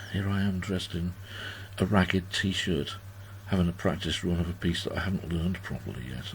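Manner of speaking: low, subdued aside; retold dismay. Player caught live in a practice run, retold dismay. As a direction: imitate a deflated inner voice.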